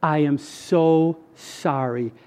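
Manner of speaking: low, soft, very slow voice; hushed, sorrowful apology, drawn out; God. Retold or imitated. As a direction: speak simply and gently, weighing each word. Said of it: "I heard the voice of God, and it simply said".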